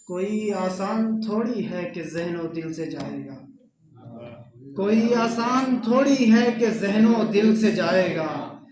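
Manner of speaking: measured mushaira delivery with drawn-out words; poetry recitation. Acted. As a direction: recite the couplet to the gathering, line by line. (मुशायरे के अंदाज़ में शेर पढ़ना)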